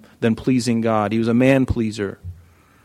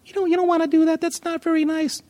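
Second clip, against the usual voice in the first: much higher, sweet little angel voice; high prim angel pleading. Acted. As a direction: play the tiny good angel on his shoulder. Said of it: "the little angel".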